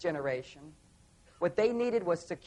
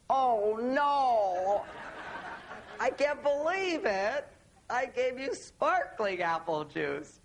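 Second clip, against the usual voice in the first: higher, slow laughing dismay; dismayed realization; 5 semitones higher, much slower than usual. Retold or imitated. Husband realizes his anniversary blunder, laughing dismay. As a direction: gasp at your own mistake, laughing. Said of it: "And that moment I go".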